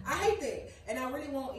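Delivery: loud and high, fed up; exasperated outburst. Real, not acted. Venting about people mixing up East Texas, a loud exasperated outburst. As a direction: blurt it out, really annoyed. Exasperated after acted exchange.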